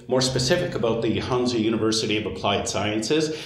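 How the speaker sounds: ordinary talk, nothing marked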